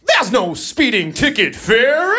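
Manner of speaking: high, slow, sing-song mock clerk voice; sassy clerk. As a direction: drawl it out like a sassy auntie. Sweet clerk calling him baby.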